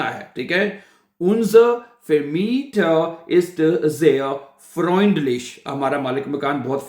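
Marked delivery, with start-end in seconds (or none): 1.2-5.4 very slow, separated German words; slow model sentence. Shown as a demonstration. Teacher repeats the landlord example, very slowly. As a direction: spell it out slowly, word by word.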